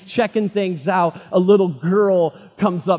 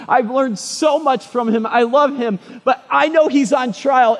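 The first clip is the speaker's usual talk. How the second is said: raised, urgent wondering; anxious inner debate; 7 semitones higher, 5 dB louder than usual. Retold or imitated. Peter at the gateway agonizing whether to run, high and urgent. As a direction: torn between fleeing and staying.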